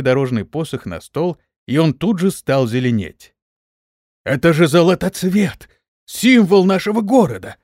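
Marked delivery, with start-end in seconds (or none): 4.2-7.6 high, loud amazed exclamation; astonished. A narrator's reading. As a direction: exclaim it in awe at the sight. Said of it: "проговорил поражённый глава совета". (поражённо, высоко и громко)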